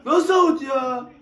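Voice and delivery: slow, loud indignant protest; outraged protest. Real, not acted. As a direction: drag out the disbelief, loudly. Protests the out call.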